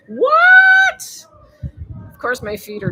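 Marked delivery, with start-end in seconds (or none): 0.1-1.2 a very high, loud, stunned what; shocked what. Real, not acted. Vlogger reading a weird feet comment, a loud squeal. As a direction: squeal in disbelief.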